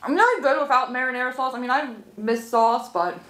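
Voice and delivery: speaking between bites; talking while chewing. Real, not acted. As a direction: mouth partly full of food. Seen 0: bites stick at -1.0, cheeks full, chewing between words.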